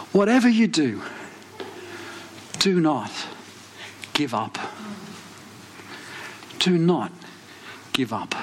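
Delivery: measured plea with long pauses, falling away softly; solemn urging. Retold or imitated. Friend's solemn word from God, retold.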